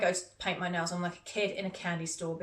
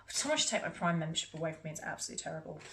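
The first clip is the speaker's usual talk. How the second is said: ordinary talk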